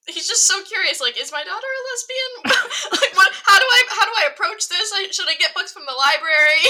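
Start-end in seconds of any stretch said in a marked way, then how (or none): 1.2-6.6 worried dad. Retold or imitated. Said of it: "from Charlie's perspective".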